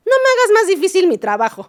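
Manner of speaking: high, loud, fast mock plea; mimicked psychologist. Daughter mimicking the psychologist to her mother, high loud mock plea. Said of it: "la psicóloga, es que, es que casi, casi te dice".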